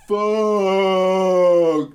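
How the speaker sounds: loud, drawn-out slow-motion yell; slow-mo action hero. Retold or imitated. Host imagines contestant turning round mid-chase, slow-mo mock yell. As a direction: stretch each word like a film hero in slow motion. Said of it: "imagine it in slow-mo".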